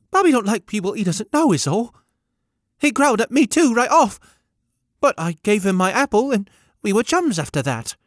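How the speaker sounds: put-on high, loud small boy announcing his name; boy calling out his name, high and loud; boy's high eager voice. A narrator's reading. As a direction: pipe up proudly as a young lad.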